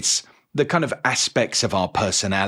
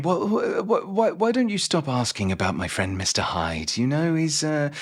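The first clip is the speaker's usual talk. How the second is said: higher, hesitant, stammering warning; mock flustered gentleman, pitched up; Doctor Jekyll; 5 semitones higher than usual. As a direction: stumble nervously into a polite warning. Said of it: "trying to warn him off".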